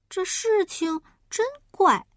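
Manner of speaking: high, puzzled little pig voice; a small pig wondering aloud; piglet voice. Acted. Piglet talks to himself, puzzled and high.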